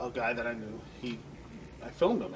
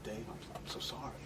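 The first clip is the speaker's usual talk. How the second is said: much softer, whispered sympathy; hushed condolence; 4 semitones lower, 11 dB quieter than usual. Retold or imitated. Manager answering the grieving father, whispered sympathy. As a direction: barely breathe it out in shock. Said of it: "And I'm like".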